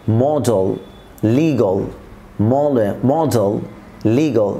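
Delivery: "মডেল" as "modol", "লিগ্যাল" as "ligol"; corrected "-ol" ending. Shown as a demonstration. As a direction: round the last vowel to o in "মডেল" and "লিগ্যাল". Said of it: "উচ্চারণ করতে হবে অল".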